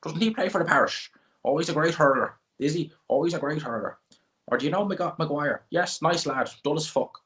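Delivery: high-pitched Midlands Irish pub gossip; Midlands Irish accent. Shown as a demonstration. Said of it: "That's what they're like".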